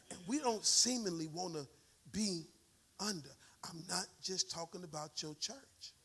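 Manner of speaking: soft, intimate sermon voice; quiet preaching. Real, not acted. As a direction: bring it down to a near hush. Preacher drops voice after climax.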